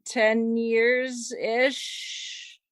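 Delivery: usual speaking voice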